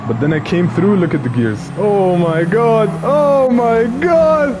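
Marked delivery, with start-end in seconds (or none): 1.8-4.5 shrieking jackpot winner. Real, not acted. Watching replay, screaming with joy.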